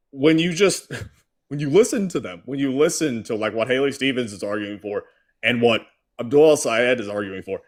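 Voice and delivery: ordinary delivery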